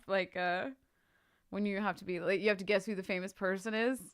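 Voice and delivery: usual speaking voice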